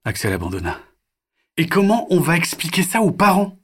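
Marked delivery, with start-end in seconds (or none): 1.6-3.6 put-on high, lively kids' banter; mock-announce to the parents, then muse on a name; chatty child voices. The children joking about telling their parents, high playful voices.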